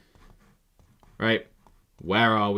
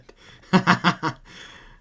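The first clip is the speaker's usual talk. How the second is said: loud, high laugh; real high-pitched cackling laugh; burst of laughter; 9 semitones higher, 9 dB louder than usual. Real, not acted. Laughs at place name.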